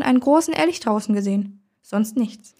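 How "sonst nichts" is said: ordinary delivery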